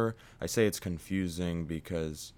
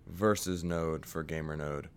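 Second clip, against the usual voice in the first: ordinary talk, nothing marked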